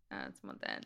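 low, very soft mumbled imitation; weary excuse. Imitating a boyfriend's stock work excuse, weary. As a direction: imitate a partner's drained, toneless excuse.

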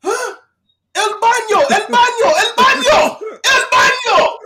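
hammed-up guard shouting at him; mock security guard. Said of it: "he's just all like".

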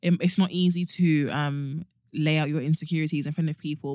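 normal talking voice

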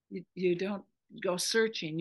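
normal talking voice

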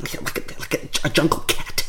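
breathy unvoiced purr; whispered purr. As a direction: purr it like a jungle cat.

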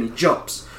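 normal talking voice

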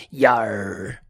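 mock pirate. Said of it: "That's all I got for a pirate".

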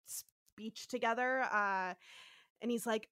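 ordinary delivery

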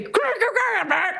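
high garbled growls round a bit; gagged protest noises. Retold or imitated. Protesting with a bit in the mouth, garbled noises. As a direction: jabber wordlessly as if gagged. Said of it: "there's a bit in my mouth".

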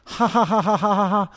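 high squeaky mock laugh; childish mocking laugh. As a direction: cackle like a smug kid. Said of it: "that's the voice I'm gonna use".

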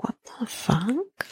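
quiet, drawn-out, rising high; baffled disbelief. Retold or imitated. Recapper's baffled reaction to sudden bawling.

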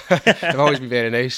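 loud laugh through the words; laughing it off cheekily. Real, not acted. Teasing a beer sponsor, laughing loudly.

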